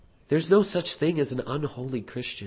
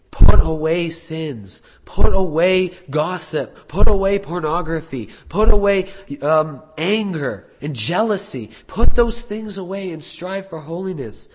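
loud exhortation, each "put away" hit hard; forceful command; 3 semitones higher, 7 dB louder than usual. Acted. Preacher voicing God's commands in Scripture, forceful command.

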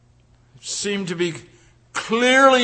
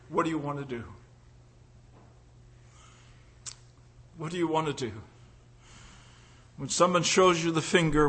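low, soft, intimate preaching; confiding pulpit murmur; hushed sermon; 7 semitones lower, 7 dB quieter than usual. Acted. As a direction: draw them in quietly.

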